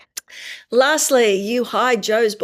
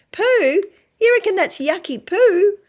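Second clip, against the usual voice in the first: put-on much higher child-directed voice, questioning; playful parent; 11 semitones higher, 4 dB louder than usual. Shown as a demonstration. Parent being Harley's voice over the mud, playful high questioning. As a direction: play up the yucky word for a little one.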